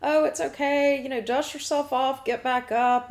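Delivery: breezy parent. Retold or imitated. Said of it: "they're like".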